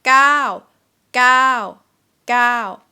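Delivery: gao drawn out, loud, rising then falling; long falling tone. Shown as a demonstration. Teacher modelling the long vowel, loud falling tone. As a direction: hold gao long and drop it. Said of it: "And this word is long".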